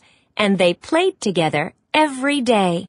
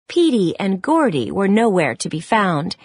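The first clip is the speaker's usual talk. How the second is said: ordinary delivery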